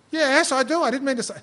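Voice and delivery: high, loud mock protest; imitated flustered protest. Student confronted afterwards about loving God, imitating flustered protest.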